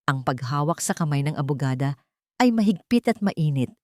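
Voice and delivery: ordinary delivery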